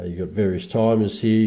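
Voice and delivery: normal talking voice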